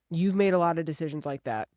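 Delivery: ordinary delivery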